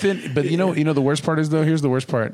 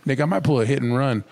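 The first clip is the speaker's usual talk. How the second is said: ordinary talk, nothing marked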